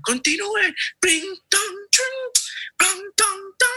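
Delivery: very high tinkling bell and drum noises; imitated bells. Storyteller sounding the caravan moving on, very high bells. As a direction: ring out the departing caravan with your voice.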